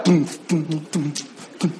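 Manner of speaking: soft mouthed bass thumps fading away; beatbox a techno kick, dying down; mouthed club beat. Retold or imitated. Imitating club music beat. (imitando el bombo de una fiesta electrónica)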